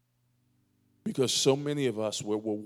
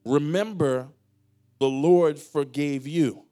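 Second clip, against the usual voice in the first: louder, slow, emphatic preaching; forceful preaching; 3 semitones higher, 6 dB louder, slower than usual. Acted. Pastor hammering home forgiveness to the church, loud and slow. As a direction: drive each word home to the congregation.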